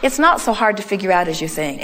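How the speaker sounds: usual speaking voice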